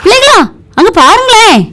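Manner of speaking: high-pitched, urgent call. Acted. Teacher calling pupils to look at a beating, alarmed.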